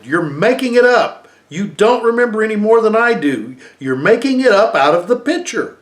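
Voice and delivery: put-on high, loud child's shout; a little boy shouting his brother down; accusing shout. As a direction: yell it at him, accusing. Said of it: "Christopher had shouted".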